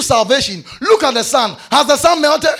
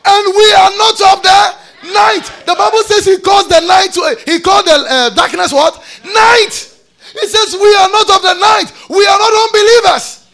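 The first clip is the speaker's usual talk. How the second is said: high, loud proclamation of scripture; fiery pulpit delivery; full-force preaching; 8 semitones higher, 8 dB louder than usual. Real, not acted. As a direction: drive the verse home in ringing high shouts.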